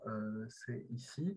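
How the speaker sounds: ordinary delivery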